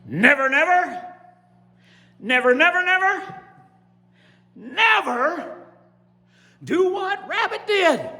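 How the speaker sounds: high, ringing, emphatic character voice; defiant story character. Acted. Story character vowing never to copy Rabbit, high and emphatic. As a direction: pitch it up high and declare each word.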